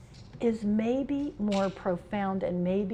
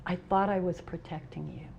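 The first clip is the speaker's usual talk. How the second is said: mom's sad reply; 4 semitones lower, 6 dB quieter than usual. Retold or imitated. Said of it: "And she said".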